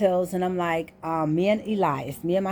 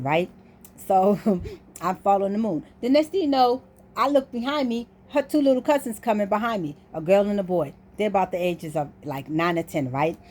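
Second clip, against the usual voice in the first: ordinary talk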